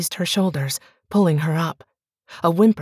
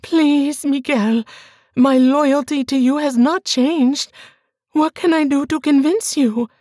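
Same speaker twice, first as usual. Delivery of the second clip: much higher and louder, begging; desperate pleading wife; 9 semitones higher, 4 dB louder than usual. Acted. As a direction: beg him for belief. Bianca begs.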